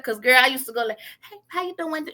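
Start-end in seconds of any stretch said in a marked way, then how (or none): 1.3-2.1 high, soft put-on greeting; self-mocking, falsely proper high voice; covering up accent. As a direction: mimic your dressed-up voice, pitched way up. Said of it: "trying to cover it up".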